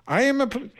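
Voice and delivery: high-pitched, affronted reading; indignant email tone. Retold or imitated. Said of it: "can't believe the tone of this".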